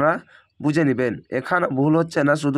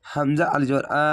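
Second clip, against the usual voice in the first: normal talking voice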